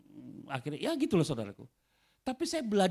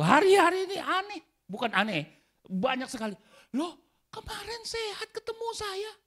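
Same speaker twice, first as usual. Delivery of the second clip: high, loud, straining sermon cry; fiery preaching; 6 dB louder than usual. Real, not acted. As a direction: pull the congregation in with urgency. Preaching at full force.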